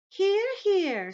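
very high, loud ladybug voice; cheering ladybug. Acted. Ladybug backing the grasshopper, high loud cheer.

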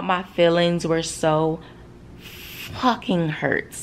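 "fucking" stretched out slowly for emphasis; emphatic hurt. Real, not acted. Stressing how hurt they felt.

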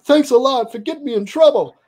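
high, whiny mock-complaint; husband blames his friend for the trouble, high and whiny.

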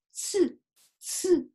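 つ said alone as a clear, full syllable, twice; firmly pronounced tsu. Shown as a demonstration. Teacher modelling the big tsu for learners, つ firmly and separately pronounced. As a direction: sound つ out fully, each one separate. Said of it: "しっかりつと発音します".

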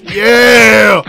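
loud drawn-out whoop; big, loud yell of delight; excited cheer. Real, not acted. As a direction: shout a long triumphant "yeah". Cheering the laptop smash.

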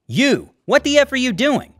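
retold furious confrontation, voice jumping up; angry yell. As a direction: bark it up high in outrage. Angry customer confronting Greg.

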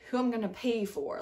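usual speaking voice

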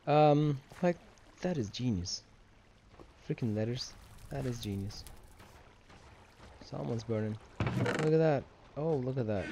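usual speaking voice

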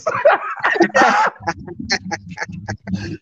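big high laugh bursting out. Real, not acted. Laughing at the wife's comment.

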